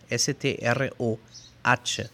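ordinary talk, nothing marked

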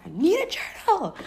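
high, loud, urgent; a sudden high cry of need; frantic need. Retold or imitated. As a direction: blurt it out in a panic. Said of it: "I was like".

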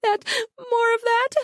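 very high, near-crying moan; moaning plea. Acted. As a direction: beg through the moans. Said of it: "I moaned".

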